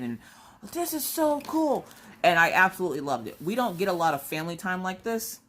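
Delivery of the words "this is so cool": put-on high, slow, awestruck kid voice; kids' amazement. Kids at the top of the cliff, awestruck. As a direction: gasp it out like a wide-eyed child. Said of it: "wide-eyed and all excited".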